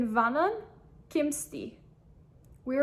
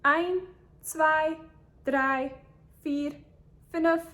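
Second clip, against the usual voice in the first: brisk counting, a bit quicker and louder; a little faster; 2 semitones higher, 4 dB louder, slower than usual. Shown as a demonstration. Teacher counting again a little faster for students. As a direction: rattle off the numbers faster. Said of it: "a little faster".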